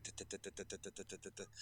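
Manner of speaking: very soft, even clock ticks; quiet, regular tongue-tip ticking. Retold or imitated. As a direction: imitate a countdown clock under the breath. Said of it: "and it was like".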